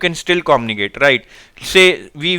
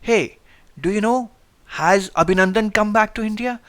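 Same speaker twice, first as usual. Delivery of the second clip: much softer, hushed and confidential; secretive low voice; 3 semitones higher, 6 dB quieter than usual. Shown as a demonstration. Teacher demonstrating decreased pitch to the class, soft secretive hush. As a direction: lean in and share a secret softly. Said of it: "This is like you are talking something secretive".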